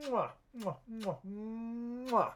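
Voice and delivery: high smacking kisses for a pet; kiss noises. Real, not acted. Streamer showering her cat Zan with kisses, high smacking mwahs.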